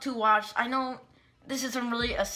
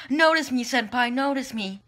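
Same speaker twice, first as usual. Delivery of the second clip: higher, louder pretend begging for attention; mock fan plea; 5 semitones higher, 6 dB louder than usual. Gushing over his favorite YouTuber, hammed-up plea. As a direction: play the pleading fan, starting high.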